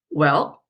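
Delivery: "Well" dipping low then lifting; falling-rising tone. Shown as a demonstration. Teacher showing third tone, "Well" down then up. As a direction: let "Well" sink and curl up hesitantly. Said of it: "It's going down and up".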